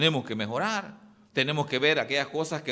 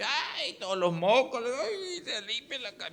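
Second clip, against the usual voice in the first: high, wailing "ay" with a laugh, imitating a weeping drunk; mock drunk crying; 9 semitones higher than usual. Preacher mocking a crying drunk for the congregation, high mock wail. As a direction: ham up a blubbering drunk wiping his nose.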